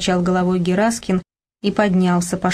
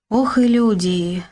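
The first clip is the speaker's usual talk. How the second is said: slow, weary sigh of dismay; 3 semitones higher, slower than usual. A narrator's reading. Older neighbour lamenting the squabbling after a death, sighing. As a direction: sigh the words out slowly. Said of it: "вздохнул Егор Петрович".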